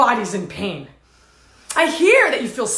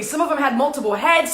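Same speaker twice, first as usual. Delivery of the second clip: normal talking voice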